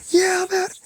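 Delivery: high, loud burst of joy; excited yell. Retold or imitated. Student finally done with school, excited high shout. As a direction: shout it like freedom just arrived.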